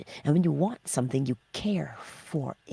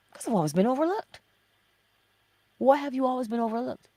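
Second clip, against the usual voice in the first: fast, higher back-and-forth; quick inner dialogue; 6 semitones higher, faster than usual. Pretend. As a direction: rattle off the excuse.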